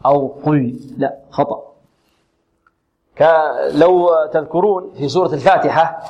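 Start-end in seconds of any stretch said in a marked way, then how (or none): none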